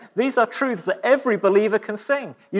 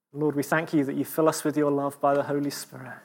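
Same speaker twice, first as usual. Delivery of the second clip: soft, lower prayer over music; closing prayer; 5 semitones lower, 3 dB quieter than usual. Acted. Preacher closes the meeting in prayer, soft and low. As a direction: pray tenderly to end the meeting.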